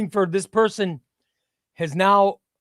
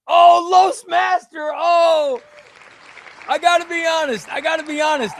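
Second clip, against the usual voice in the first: dismayed shout; 10 semitones higher, 7 dB louder than usual. Real, not acted. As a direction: howl the name like your pick just lost. His favourite gets eliminated.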